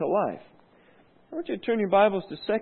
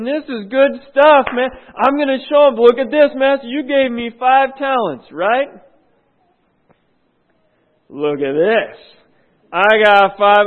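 excited boasting servant; 4 semitones higher, 9 dB louder than usual. Acted. Servant shows his five extra talents to master, loud excited boasting. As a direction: proudly present your winnings. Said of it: "he's excited".

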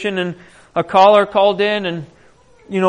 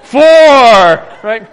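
called-out count, much louder; 3 semitones higher, 10 dB louder than usual. Retold or imitated. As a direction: announce each dip to the crowd. Counting dips in the river.

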